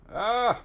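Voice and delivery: sharp high cry of alarm; startled yelp. Real, not acted. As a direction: yelp as the ship lurches. Startled while flying the ship.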